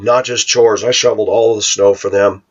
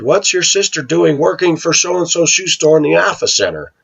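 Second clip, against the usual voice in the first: nosy townspeople; 9 semitones higher than usual. Retold or imitated. Nosy townspeople question the aunt.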